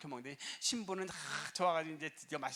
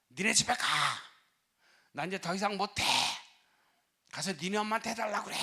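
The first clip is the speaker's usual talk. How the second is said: louder, higher angry yell at the husband; furious outburst; 3 semitones higher, 6 dB louder than usual. Retold or imitated. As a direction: throw the words at him. Bride blows up at husband.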